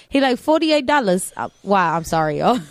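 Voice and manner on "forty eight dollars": lightly mocked Vietnamese accent, higher. Nail tech quoting the price, a light mock Vietnamese accent. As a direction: imitate the nail tech's Vietnamese accent, half-joking. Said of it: "did you really have to mock the accent".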